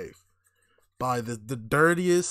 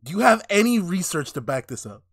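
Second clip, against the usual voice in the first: raised, louder challenging question; argumentative challenge; 5 semitones higher, 6 dB louder than usual. Real, not acted. Co-host disputing the kid's nationality, challenging. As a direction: demand proof, pitched up and forceful.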